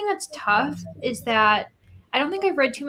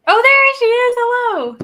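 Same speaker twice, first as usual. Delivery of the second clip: high, loud happy hello; excited greeting; 13 semitones higher, 12 dB louder than usual. Real, not acted. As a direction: sing out a bright welcome. Greeting friend joining call.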